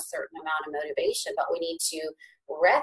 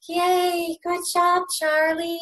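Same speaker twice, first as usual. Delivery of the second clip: put-on high, drawn-out cheer for a baby; cheering grandparents; 10 semitones higher, slower than usual. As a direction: imitate proud family cheering on a little one. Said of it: "You hear him in the background".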